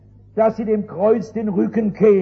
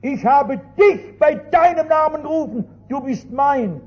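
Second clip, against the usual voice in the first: solemn scripture reading. Retold or imitated. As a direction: read it like God's own promise, rising at the end. Reading Isaiah aloud, building. (feierliche Bibellesung, zum Ende hin lauter)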